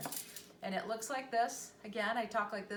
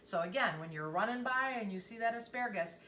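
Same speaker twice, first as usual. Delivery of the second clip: ordinary talk, nothing marked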